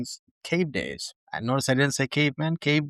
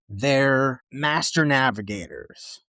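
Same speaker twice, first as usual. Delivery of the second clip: ordinary delivery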